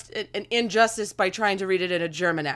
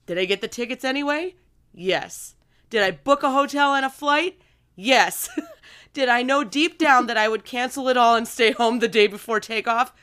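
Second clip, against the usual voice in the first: questions rising, louder and higher toward a laughing "Hell yes"; enthusiastic reading; 5 semitones higher, 5 dB louder than usual. Acted. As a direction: build it up and burst into a laugh at the end. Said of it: "I'll read it with enthusiasm".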